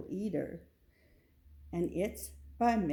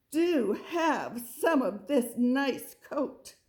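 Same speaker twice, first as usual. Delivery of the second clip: slow, coaxing offer; a parent coaxing slowly at breakfast; 4 semitones higher, 4 dB louder, slower than usual. Acted. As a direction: tempt the child slowly. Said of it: "said Father Goat".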